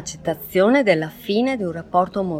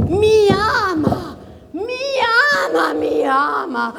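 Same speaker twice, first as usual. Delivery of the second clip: obsessive lovesick woman; 11 semitones higher than usual. Acted. Obsessive lover's monologue.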